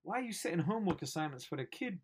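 ordinary delivery

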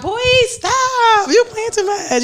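high, singsong scold; playful boy stop. Shown as a demonstration. Woman singing her stop line as a song hook, playful. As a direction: tease him while telling him off. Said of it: "I like saying stop in, like, different ways".

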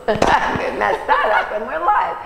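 giggling through a slip-up; laughing at a flub. Real, not acted. Host laughing after fumbling her live intro. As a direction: laugh off the mistake. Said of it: "Sorry about that".

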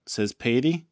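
usual speaking voice

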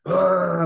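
loud, high mock roar; imitated beer roar. Imitating Kelce chugging beers in the stands, loud roar. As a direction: holler a wordless "ah" like a rowdy fan. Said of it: "when he was doing the whole".

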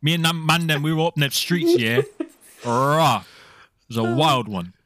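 lads' banter in a fake Liverpool accent; cheeky roadman Scouser, lightly put on; put-on Scouse accent. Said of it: "props to you, [name]. That was good".

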